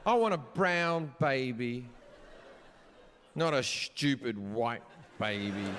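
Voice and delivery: slow, low mock-wife wishing; hammed-up slow, low wish; retold pregnant wife. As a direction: imitate a wife crooning to her bump, drawn out. Wife retold stroking pregnant stomach.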